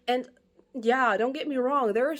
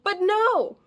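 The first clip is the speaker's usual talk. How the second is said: loud, higher cry of protest; exasperated outburst; 8 semitones higher, 6 dB louder, much slower than usual. Real, not acted. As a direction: burst out in fed-up denial. Disappointed outburst about the book.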